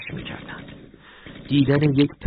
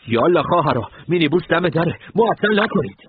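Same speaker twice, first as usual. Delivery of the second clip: higher and louder, rising urgent call; 9 semitones higher, 5 dB louder than usual. A narrator's reading. Commander hurries the women to the minibus, loud and urgent. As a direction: hurry them out the door.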